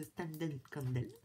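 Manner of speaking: Standen Kandel repeated slowly in English style; English-style name. Shown as a demonstration. Vlogger repeats the brand name slowly, English style. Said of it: "C'est mieux, hein".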